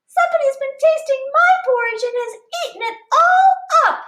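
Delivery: put-on squeaky baby bear wailing; little wee bear crying. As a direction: cry it out as the tiny bear. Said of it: "cried the little wee bear in his little wee voice".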